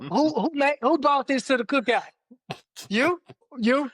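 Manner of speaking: high mock-indignant interrogation; angry chef. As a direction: play an outraged cook. Mock outrage at cookout.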